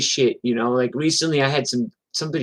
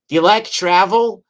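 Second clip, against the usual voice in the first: higher, louder mock come-on; baiting question; 6 semitones higher, 6 dB louder than usual. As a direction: lightly act out a woman setting up her punchline. Acts out Norma baiting drunk heckler.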